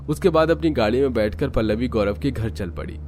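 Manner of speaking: plain reading voice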